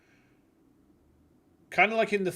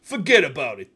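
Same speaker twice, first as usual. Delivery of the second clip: put-on New York mobster accent; 7 dB louder than usual. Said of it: "Oh, he said it".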